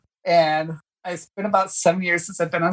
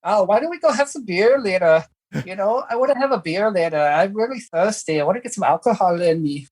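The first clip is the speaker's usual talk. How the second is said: higher-pitched amateur Irish accent; 3 semitones higher than usual. Shown as a demonstration. Guest shows the hosts the Irish accent for the play, in an Irish accent. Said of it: "can give it a shot right now".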